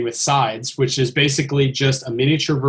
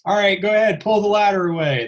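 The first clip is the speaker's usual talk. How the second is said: higher-pitched imitation of a crew command; relaying a set command in a raised pitch; 6 semitones higher, faster than usual. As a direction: call out an order like film crew. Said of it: "I start hearing all the commands".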